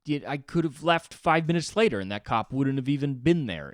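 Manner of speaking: loud, high, exasperated outburst; venting at the bad luck; frustrated rant. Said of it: "I was like".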